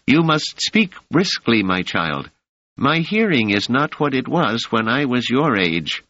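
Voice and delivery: put-on high, gentle old woman's welcome. A narrator's reading. Mother Rita speaks.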